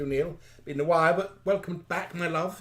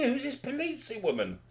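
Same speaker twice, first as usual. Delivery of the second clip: mock indignation; 6 semitones higher, 6 dB quieter than usual. Acting out reaction to new presenter.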